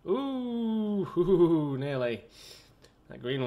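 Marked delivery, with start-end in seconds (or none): 0.1-1.8 loud high "ooh" tumbling downward; relieved hooting laugh, falling in pitch; excited whoop. Real, not acted. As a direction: whoop at a narrow escape. Near miss in the game.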